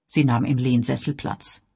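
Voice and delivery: ordinary delivery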